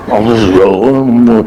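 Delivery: low, content grunts while chewing; munching Ozzy. Retold or imitated. Ozzy stuffing his face, low chewing grunts. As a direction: sound pleased through a full mouth.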